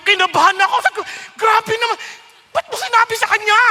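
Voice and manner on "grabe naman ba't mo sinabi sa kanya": panicked protest. Retold or imitated. His panicked inner reply.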